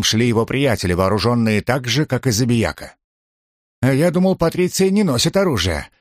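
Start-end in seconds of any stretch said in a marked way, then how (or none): 3.8-5.9 higher-pitched indignant retort; mildly angry narrated challenge; rising anger. A narrator's reading. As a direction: throw it back, pitch lifted, anger kept in check. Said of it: "в котором наконец-то разгорелся гнев".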